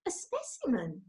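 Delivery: soft, puzzled little bear. A narrator's reading. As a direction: ask it quietly, confused. Paddington puzzled.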